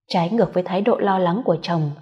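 ordinary delivery

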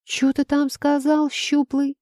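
high shrill furious shriek. Acted. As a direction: scream it across the corridor. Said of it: "Визг Вихрастова".

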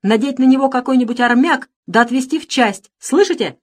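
high, loud orders; heated, insistent command; angry command. A narrator's reading. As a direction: order the servants about in anger. Master orders servant taken away.

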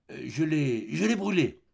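stammered, rising excuse; flustered lie. A narrator's reading. Cousin lying about the letter, faltering.

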